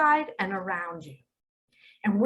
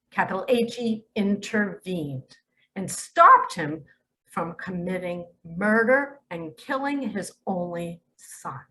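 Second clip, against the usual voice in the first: ordinary talk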